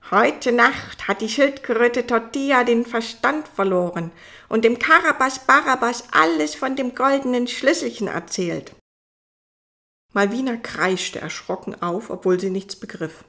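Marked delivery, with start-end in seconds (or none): 0.1-8.7 loud, odd toad voice; croaking toad. Acted. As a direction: croak out the news.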